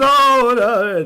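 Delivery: very high, very loud mock wail; Katharine Hepburn. Voice actor re-doing the invented guy's voice, loud high impression. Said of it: "sounding like Katharine Hepburn a little bit".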